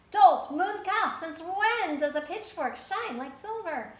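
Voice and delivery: high, very loud, slow furious yell; outraged yell. A narrator's reading. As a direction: berate the farmer, full force. Outraged townsfolk yell at farmer.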